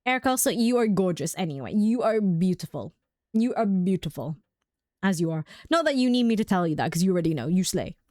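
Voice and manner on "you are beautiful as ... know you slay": ordinary delivery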